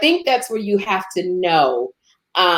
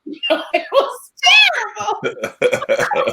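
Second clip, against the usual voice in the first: laughing; much slower than usual. Real, not acted. Laughing about bad auditions.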